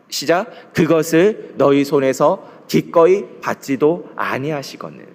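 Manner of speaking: slow, measured reading of the verse; solemn scripture recitation. Acted. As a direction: read the verse together, deliberately. Congregation reads scripture aloud.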